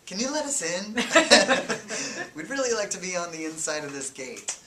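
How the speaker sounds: loud, high, breaking into laughter; laughing plea. Retold or imitated. Journalists begging the Guardsman to open the gate, laughing loudly. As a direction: beg to be let in while cracking up.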